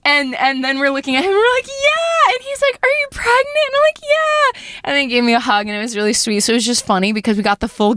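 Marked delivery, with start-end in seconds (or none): 1.7-2.3 high-pitched excited confirmation; mock squeal of joy; retold gleeful yes. Confirming pregnancy to dad. 2.8-3.5 high-pitched amazed question; mock high incredulous delight; imitated astonishment. Voicing dad's stunned question. 4.0-4.6 high-pitched excited confirmation; mock squeal of joy; retold gleeful yes. Confirming pregnancy to dad.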